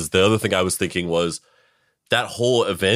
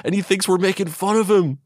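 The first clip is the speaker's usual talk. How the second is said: much higher, hushed alarm; mock alarmed hostage; 10 semitones higher than usual. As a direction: play a hostage suddenly getting it. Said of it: "you'd be like".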